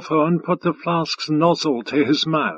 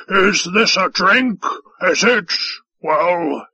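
demanding; 3 semitones higher, slower than usual. A narrator's reading. Five Tide shaking the flask at the waiter, demanding. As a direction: press the question slowly, pitched up. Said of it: "Five Tie demanded".

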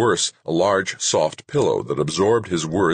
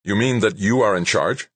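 surprised; 6 semitones higher, 3 dB louder than usual. A narrator's reading. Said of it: "blinked with surprise".

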